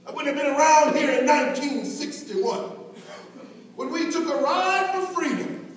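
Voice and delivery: loud, rising sermon cadence; preaching at full force. Acted. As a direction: build the refrain into a ringing climax. Building "if I had sneezed" refrain.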